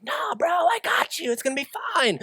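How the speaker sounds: breezy buddy reassurance; God played as a chill friend; casual God.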